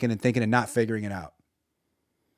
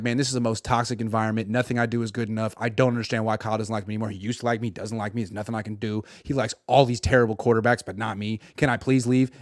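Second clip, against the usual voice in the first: lower, much softer dejected moaning; mock miserable lament; 5 semitones lower, 6 dB quieter than usual. Trey imagining his misery at the 49ers, low soft dejected moan. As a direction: sink into self-pity, quiet and low.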